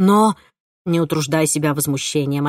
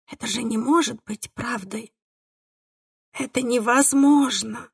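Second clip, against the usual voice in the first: tearful; 5 semitones higher than usual. A narrator's reading. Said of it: "слёзы побежали по лицу".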